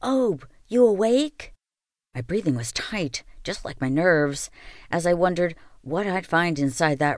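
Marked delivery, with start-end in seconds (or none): 0.0-1.5 high, slow, worried call; anxious call. Acted. Calling, unsure if he's awake.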